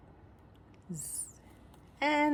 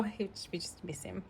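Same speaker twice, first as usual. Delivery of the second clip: whispering; 12 dB quieter than usual. Real, not acted. Sad whisper about missing cat.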